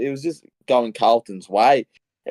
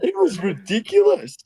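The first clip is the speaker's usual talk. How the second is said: much higher, carried away with disbelief; overwhelmed gushing; 11 semitones higher than usual. Real, not acted. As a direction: gush like you can't believe it. Gushing over an unlikely comeback win.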